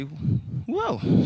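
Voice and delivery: sudden high-pitched whoa; real yelp of losing your balance; startled wobble. Real, not acted. Loses balance stepping back into lunge.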